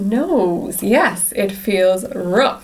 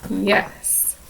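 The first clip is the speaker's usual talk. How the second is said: plain reading voice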